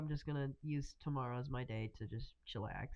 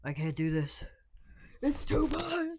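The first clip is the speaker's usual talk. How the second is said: overwhelmed outburst; 11 semitones higher, 5 dB louder than usual. Retold or imitated. Retold overwhelmed cry.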